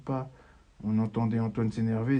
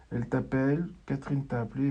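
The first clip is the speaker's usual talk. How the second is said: normal talking voice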